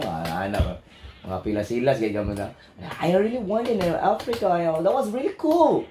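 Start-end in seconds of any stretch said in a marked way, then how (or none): 3.0-5.8 put-on higher-pitched foreign English accent. Host showing accents of English, put-on accent.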